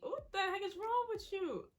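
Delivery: high, incredulous imitated question; mock bewilderment. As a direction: imitate baffled onlookers, pitch shooting up. Coworkers react to her bawling.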